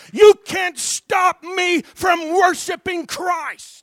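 slow, high, loud preached shout; shouted declaration. Shown as a demonstration. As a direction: belt out each word for the crowd to repeat. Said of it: "somebody say".

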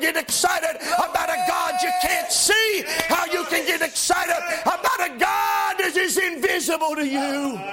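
rising, shouted gospel preaching; preaching at full force. Acted. Preacher to his congregation, shouting, fervent. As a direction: lift the congregation with fiery, shouting delivery.

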